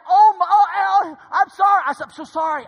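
high, loud retold panic; freaking out. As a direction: blurt out a frantic, hammed-up apology. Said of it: "well, I'm freaking out".

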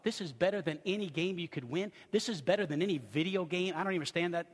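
ordinary talk

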